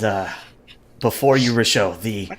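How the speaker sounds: ordinary talk, nothing marked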